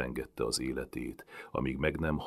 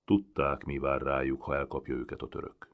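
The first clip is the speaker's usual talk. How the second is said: ordinary delivery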